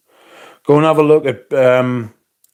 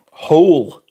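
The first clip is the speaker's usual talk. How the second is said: Whole drawn out and leaned on; Whole given heavy emphasis; stress on whole; 5 semitones higher, 4 dB louder than usual. Shown as a demonstration. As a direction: hit the word Whole hard so it sounds like hole. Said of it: "with the emphasis on the whole".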